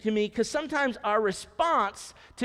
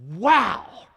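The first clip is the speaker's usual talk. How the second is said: wow; 3 semitones higher, 9 dB louder than usual. Real, not acted. Preacher's exclamation.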